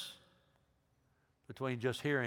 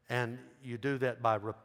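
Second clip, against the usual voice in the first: normal talking voice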